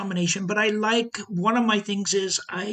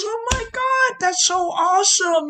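much higher and louder, gushing with delight; excited brother; 14 semitones higher, 9 dB louder than usual. Retold or imitated. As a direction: imitate the little brother squealing with joy. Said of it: "He laughed. He goes".

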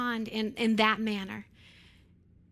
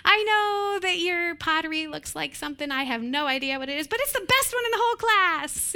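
higher mock praise with a laugh; hollow praise; 6 semitones higher, 4 dB louder than usual. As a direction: praise the pottery, laughing through it. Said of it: "those are hollow words".

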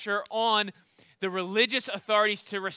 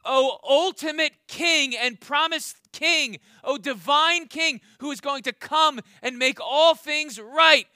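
higher, louder proclamation of a king; fervent acclamation; 5 semitones higher, 6 dB louder than usual. Retold or imitated. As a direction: hail him like a royal herald. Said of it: "Bartimaeus is effectively saying".

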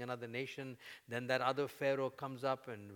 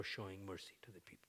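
whisper; 5 semitones lower, 11 dB quieter than usual. Real, not acted. Closing a point softly.

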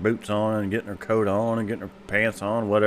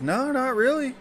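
old papa voiced much higher. Old papa answering the doctor, much higher. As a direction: squeak it out like a little old man.

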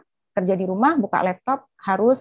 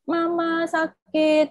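put-on whiny hurt child; 9 semitones higher, much slower than usual. Hurt child calling mother.

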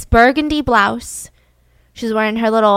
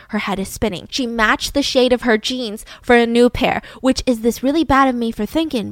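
usual speaking voice

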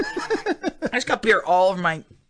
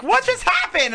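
high confused quote; 11 semitones higher than usual. Retold or imitated. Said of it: "And she's like".

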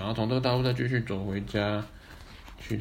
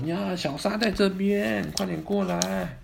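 high, slow calling out; 9 semitones higher, slower than usual. Acted. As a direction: call across the field. Calling the mother over.

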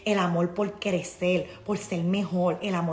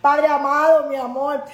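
excited greeting; 5 semitones higher, 8 dB louder than usual. Real, not acted. Reading comment loudly.

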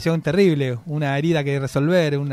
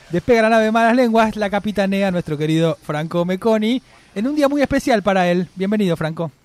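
announcer voice; 4 semitones higher than usual. Acted. Mock launch announcement.

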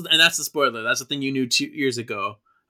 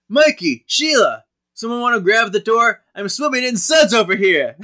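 Daryl voiced higher and louder, calling out; a harried dad shouting for help from the sink; voicing Daryl; 9 semitones higher, 10 dB louder than usual. Friendly Daryl calling through the house from the dishes, higher and louder. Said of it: "And he's like".

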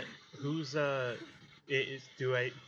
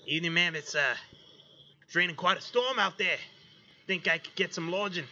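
raised, louder polite stranger; polite traveler voice; 7 semitones higher, 7 dB louder than usual. Acted. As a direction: tip your hat to the barmaid. Tiefling character greets barmaid.

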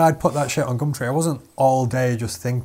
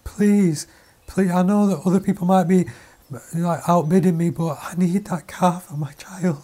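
higher, begging single mother; imitating a crying caller; 1 mock tearful plea; 7 semitones higher than usual. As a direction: plead like a desperate mum. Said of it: "she was crying, she was like".

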